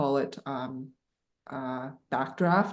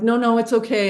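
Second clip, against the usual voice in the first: insistent refusal; 7 semitones higher, 7 dB louder than usual. Retold or imitated. Refusing help at the store.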